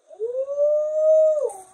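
high delighted squeal; excited squeal. Real, not acted. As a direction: squeal with joy at the reveal. Heat press reveal excitement.

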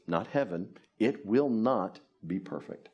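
ordinary talk, nothing marked

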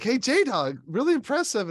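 high, loud mock praise; hyped admirer. Acts someone hailing J-Dog.

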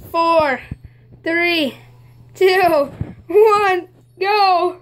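counting down loud and rising; loud countdown. Real, not acted. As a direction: call out the countdown, building.

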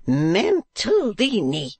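high, slow scandalised cry; awful warning voice. A narrator's reading. Wife rebuking her husband, drawn-out awful voice. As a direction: draw out the name as a high rebuke. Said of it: "in an awful voice".

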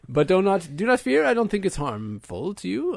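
high, loud, grand knightly comfort; reassuring knight. Acted. Knight comforting the shaken tavern keeper, high and loud. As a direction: boom it out like a noble knight soothing a peasant.

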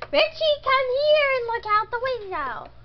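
put-on high, loud call to a child. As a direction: summon a child from a distance. Mother calls child over.

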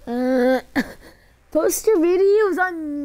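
high, loud laugh-crying; laughing hard. Real, not acted. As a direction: talk through helpless laughter. Laughing at own mistake.

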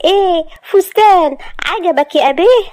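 put-on high, playful girlish taunt; teasing girl. Maria showing off her dress to Asad, teasing. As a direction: twirl and tease him in a high voice. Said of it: "وهي بتلف حوالين نفسها".

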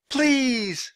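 high, long pleading cry; girl's high-pitched shouted plea. A narrator's reading. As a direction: shout one last desperate word. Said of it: "shouted Annie".

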